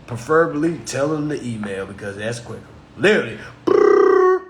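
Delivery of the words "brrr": loud high brrr like a ringing phone; phone ring imitation.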